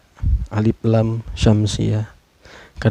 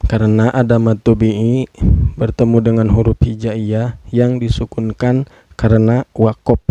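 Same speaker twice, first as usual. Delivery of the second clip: usual speaking voice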